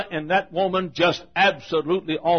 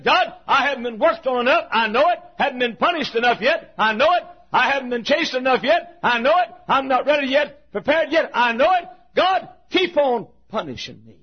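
higher, insistent repeated pleading; fervent plea; 7 semitones higher than usual. Retold or imitated. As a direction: keep begging, each line pressing harder. Jacob's pleading litany to God.

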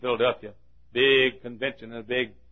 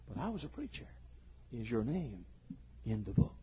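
hushed gravity; 14 dB quieter than usual. Acted. Preacher pleads his office before God, hushed solemn exchange. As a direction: let it fall very soft and grave. Sudden hush in judgement scene.

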